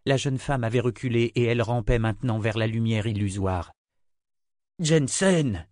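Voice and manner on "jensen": a higher, moderately raised call; crying out. A narrator's reading. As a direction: call out her name in mild alarm. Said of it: "cria le sourcier".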